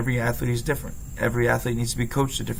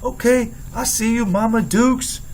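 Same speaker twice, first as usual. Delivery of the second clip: much higher, louder delighted shout; excited cheer; 11 semitones higher, 5 dB louder, slower than usual. Retold or imitated. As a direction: jump up cheering her strike. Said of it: "I jumped up with excitement".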